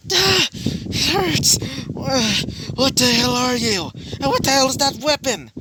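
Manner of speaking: high-pitched, alarmed yelping; acted pained outcry. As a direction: cry out high, hurt and bewildered. Shot character cries out in pain.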